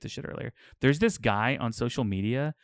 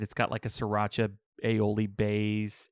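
ordinary delivery

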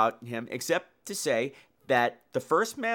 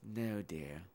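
lower, softer sugary matron voice; mock icy matron; 13 semitones lower, 13 dB quieter, slower than usual. Matron answering the new girl, lower and softer with cool menace. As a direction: purr it with quiet venom. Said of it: "And Missus Deer says".

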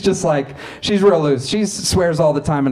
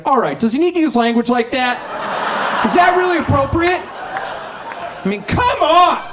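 high, laughing near-shout of indignation; hammed-up dad protesting bad language; outraged prudish dad; 7 semitones higher than usual. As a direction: sputter at the TV like a scandalized father. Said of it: "My dad's like".

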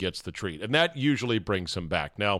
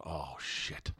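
much softer, lower, breathy dread; dismayed curse; 8 semitones lower, 16 dB quieter than usual. Retold or imitated. Owner sees his dog run into the woods, hushed dismay. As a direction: let it slip out under your breath in dread. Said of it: "my heart is in my throat, and I'm just going".

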